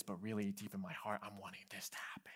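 a sly whispered inner motive; secretive whisper. Retold or imitated. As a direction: confess the secret motive under your breath. Voicing hidden selfish motives.

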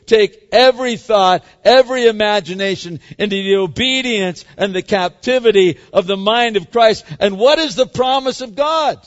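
loud, driving sermon voice; preaching at full force. Acted. Pastor preaching hard to his church, loud and driving. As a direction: preach it out to the congregation.

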